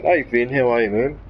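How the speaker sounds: ordinary talk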